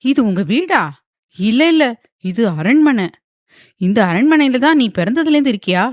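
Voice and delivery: husband amazed at his wife's family palace. A narrator's reading. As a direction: marvel at the palace in disbelief. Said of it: "ஆச்சரியமாக பார்த்தபடி".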